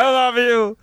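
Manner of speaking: high-pitched, stretched-out cry of love; mock scream. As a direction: wail it out in a put-on high voice. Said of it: "He just started screaming".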